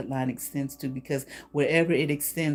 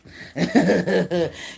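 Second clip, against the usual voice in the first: real laugh; 3 semitones higher, 6 dB louder than usual. Real, not acted. Laughs mid tarot reading.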